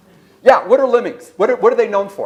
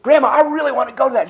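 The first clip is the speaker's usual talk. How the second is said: put-on higher, fast little-boy voice; eager little boy; 7 semitones higher, much faster than usual. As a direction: beg grandma like an excited kid. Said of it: "And he goes".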